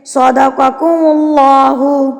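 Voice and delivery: slow सदक़कुमुल्लाहु, thick l with rounded lips; pouted heavy lam. Shown as a demonstration. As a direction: pout into a full, heavy l in सदक़कुमुल्लाहु. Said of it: "pout करके पढ़ेंगे".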